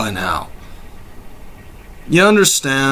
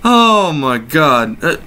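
ordinary talk

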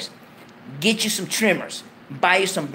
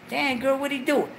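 gossiping homegirl; 7 semitones higher, 5 dB quieter than usual. Retold or imitated. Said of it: "homegirls that say".

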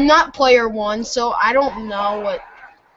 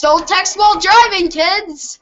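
mock safety warning; 7 semitones higher than usual. Player lecturing kids after a whoops, hammed-up warning. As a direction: call it out like a public announcement.

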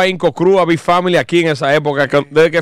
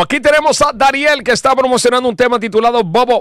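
higher, upbeat host promo voice; show host announcement; 7 semitones higher than usual. Acted. Host wraps up the episode, promoting the guest's song in an announcer voice. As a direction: sell the new track like a presenter.

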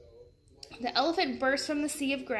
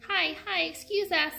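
friendly yell; 6 semitones higher than usual. A narrator's reading. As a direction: call out a greeting as you pass. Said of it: "Annie yelled".

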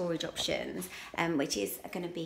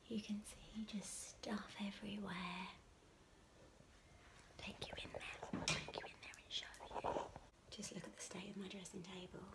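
whispering; 7 dB quieter than usual. Real, not acted. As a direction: creep round the house whispering. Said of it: "so we're gonna talk really quietly".

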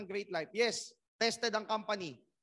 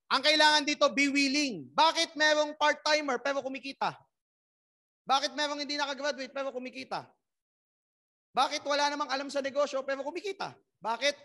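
fired-up rally; 5 semitones higher, 6 dB louder than usual. Real, not acted. Motivational speaker rallying crowd.

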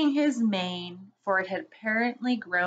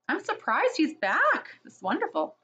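delighted; 8 semitones higher than usual. A narrator's reading. Girls rejoice at Aslan.

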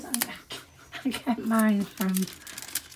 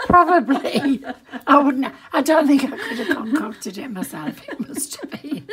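laughing; 4 semitones higher, 7 dB louder than usual. Real, not acted. Laughing about the tip.

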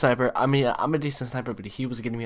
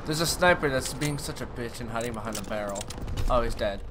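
ordinary talk

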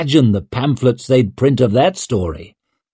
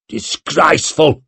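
growling; 5 semitones higher than usual. A narrator's reading. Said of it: "growled Clencher".

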